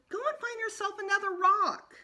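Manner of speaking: high, loud exasperated shout. A narrator's reading. Peter shouting Sheila off their rocks, high and loud. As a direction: yell her off the rock. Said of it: "I shouted".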